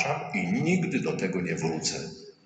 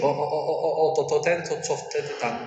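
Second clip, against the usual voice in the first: higher, dithering "o, o, o"; mock recollection; 8 semitones higher than usual. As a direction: fumble to recall an old sin. Mock God remembering sins. (wyższy, udawany namysł)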